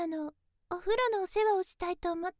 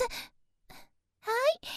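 ordinary delivery

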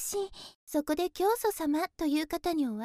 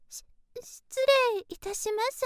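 hushed stammer, then polite; timid, hesitant politeness; nervous entrance; 4 semitones higher than usual. Acted. Nervously enters the guru's room.

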